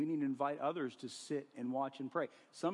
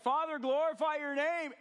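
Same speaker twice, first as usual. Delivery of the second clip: higher and louder, pleading; crying out to God; 12 semitones higher, 5 dB louder than usual. Retold or imitated. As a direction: call Father upward with full force. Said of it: "And Jesus is like".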